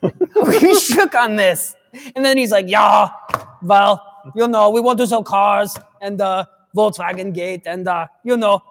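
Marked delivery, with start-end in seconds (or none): 0.0-1.7 drawn out very slowly through a laugh; slow laughing repeat. Retold or imitated. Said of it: "Subaru's like". 2.7-8.6 bad German accent. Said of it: "That is the worst German accent".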